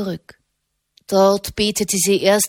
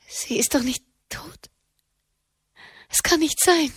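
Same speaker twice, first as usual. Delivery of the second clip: fearful disbelief; 7 semitones higher than usual. A narrator's reading. Katharina fürchtet Tod der Mutter.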